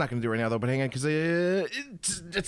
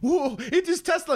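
awed gasp; 4 dB louder than usual. Pretend. Crowd awed by Tesla's arrival.

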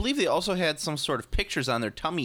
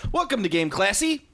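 show intro; 7 semitones higher than usual. Real, not acted. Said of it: "show intro".

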